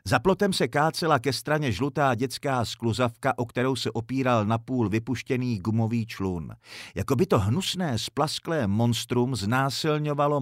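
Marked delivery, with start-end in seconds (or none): none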